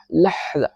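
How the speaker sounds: لحظہ with a clear breathy ح; breathy ha in lahza. Shown as a demonstration. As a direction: stress the ha sound in لحظہ. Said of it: "ہا کی آواز نکالنی ہے". (لحظہ میں ح کی آواز صاف نکالیں)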